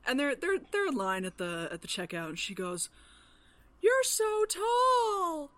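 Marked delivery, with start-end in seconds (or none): 3.8-5.5 mock little-kid yell; retold child's shout. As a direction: blurt it out loud like a small child, stretching every word. Retelling a child's blurt at checkout.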